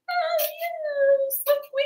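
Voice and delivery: high, syrupy mellow-person voice; mock sweet voice. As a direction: imitate someone sickly sweet and mild.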